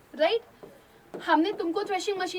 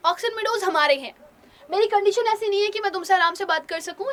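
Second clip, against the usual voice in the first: loud, higher, angry shout; 5 semitones higher, 5 dB louder than usual. Acted. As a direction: yell it at her. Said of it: "जोर से चिल्ला करके बोलता है".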